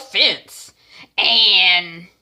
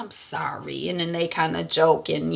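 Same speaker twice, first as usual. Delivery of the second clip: usual speaking voice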